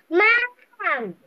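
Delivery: calling out for Mom. Acted. Student reads chicken yelling for mother, calling out. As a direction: call out like the hungry chicken.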